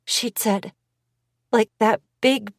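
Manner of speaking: usual speaking voice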